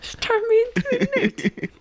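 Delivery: very high, hammed-up comic peasant; mock witch accuser. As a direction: squeal a silly accusation. Acting out comedy witch-trial accuser.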